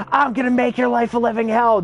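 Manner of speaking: put-on bratty outburst; flipping-out kid. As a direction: imitate a teenager's dramatic threat.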